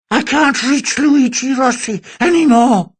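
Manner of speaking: high, slow, metallic vocoder voice; slurred, shrill and machine-like; distorted voice. Acted. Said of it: "said the deformed voice".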